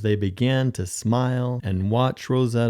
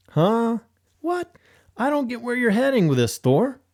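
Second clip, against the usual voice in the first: baffled; 11 semitones higher than usual. Acted. Olaf baffled by Thor.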